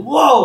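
high, loud falling wail; passing-out whoa. Retold or imitated. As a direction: cry out as you topple backwards. Barney passes out off chair.

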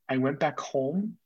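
ordinary delivery

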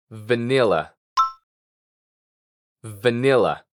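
"Vanilla" as v'nila, first A dropped, low; English pronunciation demo. Shown as a demonstration. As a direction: buzz V into N in "Vanilla". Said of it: "esa A no se pronuncia". ("Vanilla" en inglés, sin la primera A)

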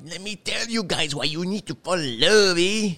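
a playful Latin love machine, all swagger. Retold or imitated.

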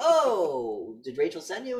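high, loud drawn 'oh'; acted-out bright recognition; mock pleased surprise. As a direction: imitate someone happily catching on. Acts out lawyer's pleased reaction.

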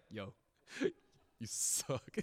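laughing through a slow, soft jab; slow, giggly mock insult; laughing tease. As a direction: tease your friend while cracking up. Teasing friend over prayer.

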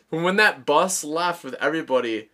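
ordinary talk

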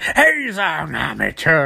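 slow, drawn-out villain sneer; Trickster. Retold or imitated. Villain dismissing a rival, hammy scorn. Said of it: "he's still like".

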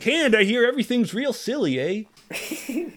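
mock Canadian accent. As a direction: parody a goofy Canadian police officer.

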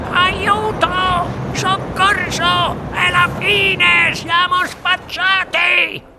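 high, long-drawn panicked wails; doomed grandfather's high shrieks; screaming for help. A narrator's reading. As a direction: wail in terror, stretching every cry. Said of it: "si mise a gridare".

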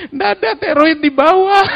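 amused loud retelling; laughing retelling. Retold or imitated. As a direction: crack up while quoting the whisper. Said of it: "Dia bisikin saya".

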